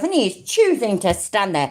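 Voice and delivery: ordinary delivery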